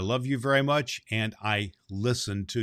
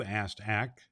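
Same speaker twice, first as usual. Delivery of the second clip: ordinary delivery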